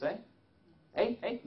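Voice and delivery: mock Canadian "eh", high and bright; Canadian accent. Speaker teasing Eric in a jokey Canadian accent. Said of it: "That was a Canadian accent".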